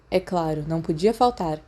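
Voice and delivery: plain reading voice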